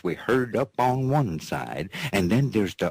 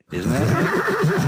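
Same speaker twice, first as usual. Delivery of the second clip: squeaky high question; 5 dB louder than usual. Real, not acted. Asks sweetheart, squeals.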